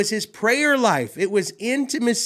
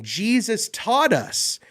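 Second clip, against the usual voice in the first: usual speaking voice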